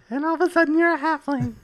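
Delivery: high halfling voice. Shown as a demonstration. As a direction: squeak it out like a tiny halfling. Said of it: "Or do it high".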